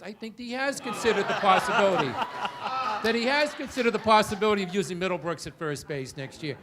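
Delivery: 笑着说; laughing. Real, not acted. Laughing while accusing him of lying.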